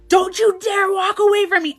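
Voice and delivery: put-on loud, high furious yell at a boyfriend; angry shout at a leaving boyfriend; aggressive. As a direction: yell it to stop him leaving. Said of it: "I was so aggressive".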